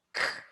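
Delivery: a single short "k" click; isolated K sound. Shown as a demonstration. Teacher repeating the sound of C, bare "k". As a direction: say "k" alone without a vowel. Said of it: "Vamos de novo?".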